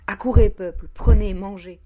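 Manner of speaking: loud ringing call to the crowd; crying out. Acted. Man calls the people to take the fruit, loud cry. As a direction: shout it out to the whole people. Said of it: "et je crie".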